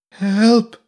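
high, loud cry for help; frightened cry. A narrator's reading. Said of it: "Elf's voice was high with fright".

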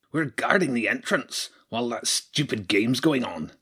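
lightly voiced grumbling rat guard. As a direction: grumble it like a bored sentry. Rat guard answers James.

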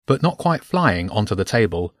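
plain reading voice

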